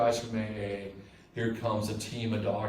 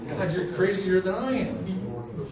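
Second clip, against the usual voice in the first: laughing; 6 semitones higher, 5 dB louder than usual. Real, not acted. Laughing retort to brother's belief.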